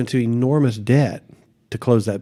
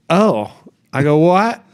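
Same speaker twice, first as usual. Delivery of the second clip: much higher, louder startled "What?"; stunned surprise; 6 semitones higher, 5 dB louder, slower than usual. Retold or imitated. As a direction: caught completely off guard. Shocked at wrong building.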